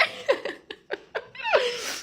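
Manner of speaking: long high laugh. Real, not acted. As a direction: laugh at the irony, high-pitched. Laughs about no children. (risada longa e aguda)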